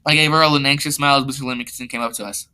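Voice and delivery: usual speaking voice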